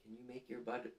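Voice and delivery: ordinary delivery, nothing marked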